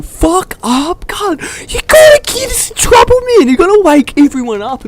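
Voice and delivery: very high, frantic telling-off; mock panicked scolding. Boys scold Barry for screaming at night, high mock outrage. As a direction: squeal at a mate to stop, hammed-up.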